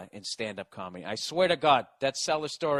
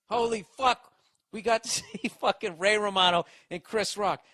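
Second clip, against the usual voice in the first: crowd thrill; 7 semitones higher, 5 dB louder than usual. Retold or imitated.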